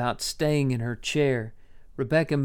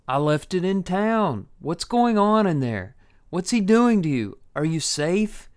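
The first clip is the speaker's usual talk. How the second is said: anxious calling; 5 semitones higher, 4 dB louder than usual. Acted. Levi anxiously calling to house.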